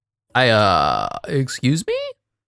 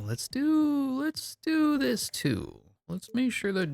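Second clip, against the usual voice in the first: silly high voice; 14 semitones higher, 8 dB quieter, slower than usual. Acted. High slow silly voice calling play.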